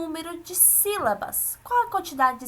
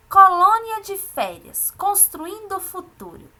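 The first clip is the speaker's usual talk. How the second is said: measured classroom read-aloud, headings hit louder; reading aloud to pupils; 4 semitones higher, 5 dB louder, slower than usual. Acted. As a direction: read it out slowly, phrase by phrase. Reads the text aloud to pupils.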